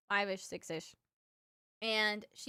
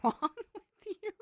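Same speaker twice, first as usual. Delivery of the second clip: laughing disbelief; 8 semitones higher, 4 dB louder than usual. Retold or imitated. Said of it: "I'm like".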